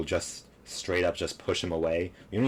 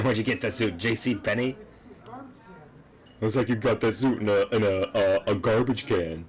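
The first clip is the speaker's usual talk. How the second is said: louder, mocking taunts about the suit; 4 semitones higher, 4 dB louder than usual. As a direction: jeer at the suit, hammed up a little.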